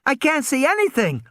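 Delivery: put-on high scared child shout; panicked little girl. A narrator's reading. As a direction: cry out blind and scared. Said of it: "Lex shouted".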